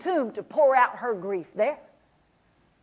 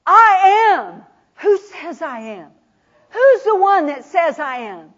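loud ringing I am; proclaiming; 3 semitones higher, 6 dB louder than usual. Acted. Jesus confronting Martha, loud proclamation. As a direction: declare it with full force. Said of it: "he turns to her and he says".

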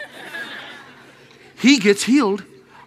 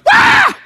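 a sudden high, loud startled cry; shrieking jump of fright; startled yelp; 11 dB louder than usual. Retold or imitated. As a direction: yelp like someone jolted awake. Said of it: "he went".

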